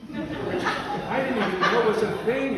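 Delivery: amused, can't believe it; laughing disbelief. Real, not acted. Telling the climate change charade, laughs in disbelief.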